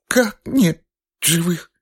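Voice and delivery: high, slow choked disbelief; stunned disbelief. A narrator's reading. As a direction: force the words out in shock. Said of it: "выдавила она из себя".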